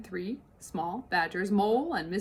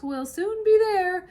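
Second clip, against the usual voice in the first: cheerful encouragement; 10 semitones higher, 8 dB louder than usual. Acted. Mr Fox encourages.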